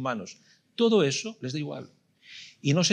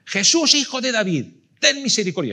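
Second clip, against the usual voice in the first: much higher, louder desperate shout; shouting even louder; 10 semitones higher, 10 dB louder than usual. Retold or imitated. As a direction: cry out with everything, over the crowd. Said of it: "grita más fuerte todavía".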